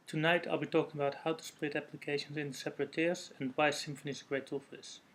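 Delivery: ordinary delivery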